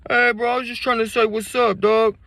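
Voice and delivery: put-on very high, loud drunken plea; drunk Jarvey. Drunk Jarvey insisting he only said hi, very high. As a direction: whine like a hurt drunk friend. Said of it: "they drunk, slurring their words".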